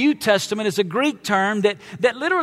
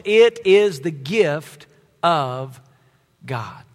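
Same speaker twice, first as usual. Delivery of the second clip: slow, deliberate verse with a pause before Faith; slow scripture reading; slower than usual. Acted. Preacher reading Ephesians aloud to congregation, slow and deliberate. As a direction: read it slowly for the room to join.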